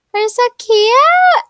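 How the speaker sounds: high, loud, slow squeal of delight. Real, not acted. Girl gushing to viewers over her outfit, squealing. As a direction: gush over how cute you look.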